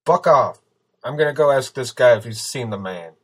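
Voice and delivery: loud, defiant shout; explosive start, still loud after; calling back angrily. A narrator's reading. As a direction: holler it back over your shoulder. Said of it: "Steele called back".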